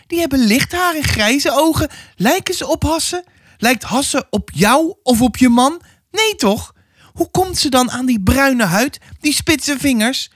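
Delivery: put-on high, pressing old woman's voice; stern old neighbour. Old neighbour warning the mother about her child, high and pressing. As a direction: lean in like a suspicious village gossip.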